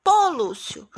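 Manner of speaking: teacher quotes the character emphatically; exclaimed. Shown as a demonstration. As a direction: exclaim "Pô, Lúcio" with a falling tune. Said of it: "quando ele falou assim".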